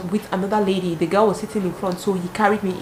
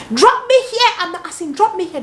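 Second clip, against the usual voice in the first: much higher, louder, imitating a furious order; mock angry demand; 10 semitones higher, 3 dB louder than usual. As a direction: snap the demand like a jealous girlfriend. Angry girlfriend demands to leave.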